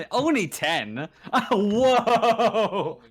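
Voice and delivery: loud, high, drawn-out whoa; laughing, over-the-top astonishment; mock amazement. Real, not acted. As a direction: tease a player with a big incredulous whoop. GM mock-amazed at player's dice pool.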